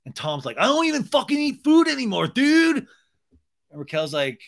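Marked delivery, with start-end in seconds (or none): 0.6-2.8 high, loud mock tantrum yell; hammed-up outraged bro. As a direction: whine it at full volume. Acted Tom's tantrum.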